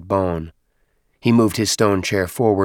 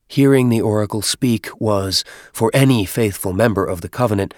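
plain reading voice

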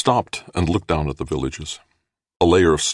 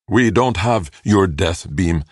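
slow, raised, measured complaint; deliberate, higher-pitched, faintly envious alien; almost envious; 6 semitones higher, 5 dB louder, slower than usual. A narrator's reading. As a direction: say it slowly with a touch of envy. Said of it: "sounding almost envious".

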